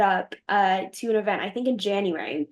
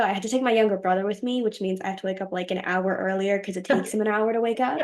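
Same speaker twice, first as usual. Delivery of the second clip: ordinary delivery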